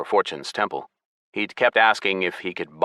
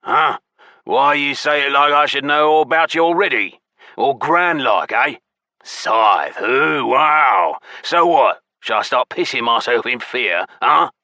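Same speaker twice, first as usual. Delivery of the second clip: loud, raised, jeering goblin voice; higher, louder mocking creature voice; belligerent goblin; 6 semitones higher, 6 dB louder than usual. A narrator's reading. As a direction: sneer and taunt as a cocky goblin.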